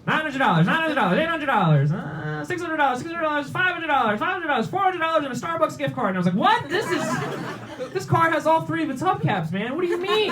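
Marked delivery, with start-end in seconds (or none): 0.1-6.1 high-pitched auctioneer's chant; auction caller. Retold or imitated. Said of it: "The big car starts at a thousand dollars". 6.4-10.3 high-pitched indignant outburst; pissed off. Retold or imitated. Car owner insulted by the auction bids, high outraged protest. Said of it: "I was pissed. I was so mad".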